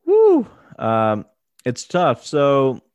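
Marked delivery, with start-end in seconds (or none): 0.1-0.5 surprised woo. Real, not acted. Reacting to upset question.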